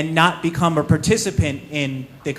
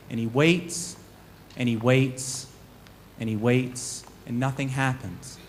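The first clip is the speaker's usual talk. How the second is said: hushed storyteller stretching the wait; suspense build; 2 semitones lower, 6 dB quieter than usual. A narrator's reading. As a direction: let the pauses hang, fading down. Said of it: "repeated waits, building suspense".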